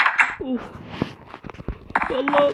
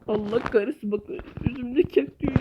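ordinary talk, nothing marked

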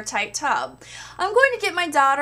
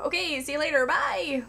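ordinary talk, nothing marked

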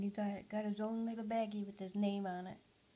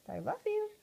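much higher, lilting sign-off; parrot imitation; 12 semitones higher, 4 dB louder than usual. Owner imitating how Zaz ends phone calls, high bird voice. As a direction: copy the bird's phone goodbye. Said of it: "He'll end his phone conversations with".

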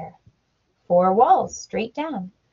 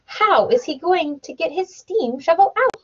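questioning villager; 6 semitones higher than usual. A narrator's reading. Voiced villager question in story.

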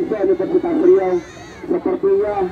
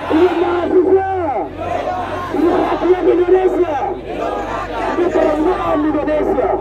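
raised rallying call; rousing the crowd; 10 semitones higher than usual. Real, not acted. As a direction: pump up the crowd. (seruan membakar semangat massa)

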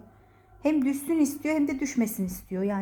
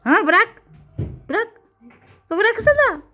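loud, raised-pitch order to a pet; sharp command; 7 semitones higher, 7 dB louder, slower than usual. Real, not acted. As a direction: order it firmly to let go. (yüksek sesle, sertçe emrederek)

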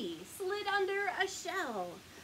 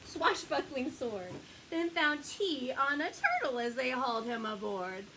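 usual speaking voice

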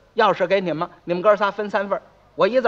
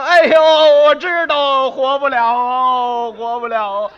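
mock wailing; 2 semitones higher, 6 dB louder, slower than usual. Brothers wail again to neighbours.

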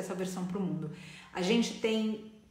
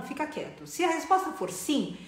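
ordinary talk, nothing marked

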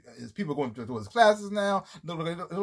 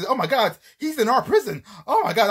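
a louder, higher, star-struck gush; starstruck guard; 6 semitones higher, 5 dB louder than usual. Retold or imitated. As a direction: gush like a fan meeting his idol. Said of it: "He like".

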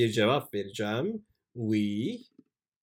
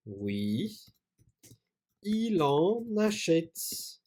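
slow dictation; 7 semitones higher, much slower than usual. Shown as a demonstration.

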